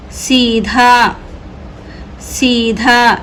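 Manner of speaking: very slow, syllable by syllable; slow drawn-out reading. Shown as a demonstration. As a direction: stretch सीधा out slowly for learners. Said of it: "teacher stretches the word for pupils".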